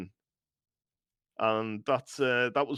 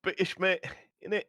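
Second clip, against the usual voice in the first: higher, mock British lad voice; British slang accent; 7 semitones higher, faster than usual.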